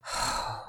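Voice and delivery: soft breathy sigh; contented sigh. A narrator's reading. Penelope sighing over her breakfast, contented sigh. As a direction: let out a soft, satisfied breath. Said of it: "Penelope sighed".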